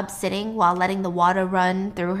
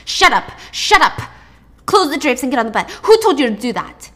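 loud, higher-pitched furious orders; angry shouting; 3 semitones higher, 7 dB louder than usual. Acted. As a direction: shout down a hostage. Knife attacker orders hostages around.

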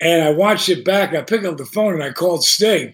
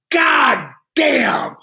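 mock anguished cry; 7 semitones higher than usual. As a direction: ham up a friend's pained outburst. Michael voiced crying out.

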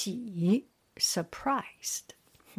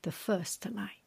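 ordinary talk, nothing marked

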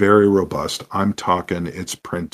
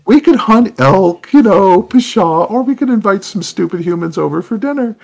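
very high, loud elf voice; hammed-up predator elf; 13 semitones higher, 7 dB louder than usual. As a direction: squeal it gleefully as a man-eating elf. Elves answer about their hunting.

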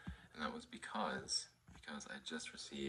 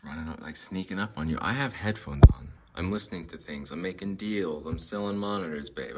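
loud rant; 14 dB louder than usual. Real, not acted. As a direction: build into an exasperated rant. Ranting about dogs while busy.

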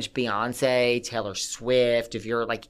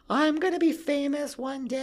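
high, grand, dreamy declaration of future fame; starlet proclamation. Retold or imitated. Teen girl at the boat's helm announcing future stardom, high and grand. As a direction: sing it out like a teen diva at the bow. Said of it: "being like".

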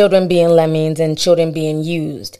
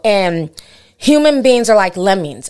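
normal talking voice

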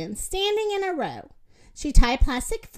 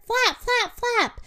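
high, urgent repeated calls; yelled urging; 10 semitones higher, slower than usual. A narrator's reading. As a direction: urge them on in a high voice. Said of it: "yelled the rooster".

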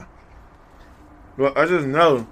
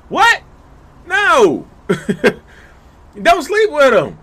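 much higher, louder shocked "What? No."; shocked outburst; 11 semitones higher, 6 dB louder, much slower than usual. Real, not acted. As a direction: burst out in disbelief, warning them off.

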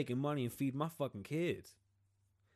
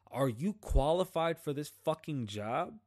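slow, louder angry demand; indignant slow rant, louder; angry challenge; 3 semitones higher, 5 dB louder than usual. Real, not acted. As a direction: hammer each word at the official.

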